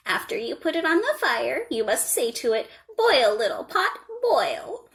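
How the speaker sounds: lightly voiced old woman, patient and kind; kindly old woman. A narrator's reading. Old woman teaching the girl the magic words, gently. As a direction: explain the magic as a gentle crone.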